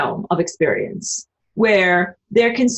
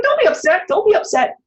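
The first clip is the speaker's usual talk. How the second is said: much higher, cutesy pet voice; voicing the dog; 10 semitones higher than usual. Voicing her dog comforting her, high and cute. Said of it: "She's like".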